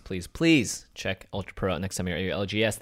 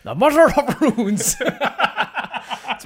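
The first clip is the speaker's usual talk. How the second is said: high, slow laughing delivery; giggling through the words; laughing announcement; 14 semitones higher, 5 dB louder, slower than usual. Pretend.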